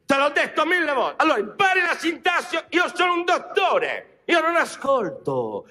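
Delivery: exasperated shout. Acted. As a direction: scold him for wrong word. Doctor lectures patient. (rimprovero urlato)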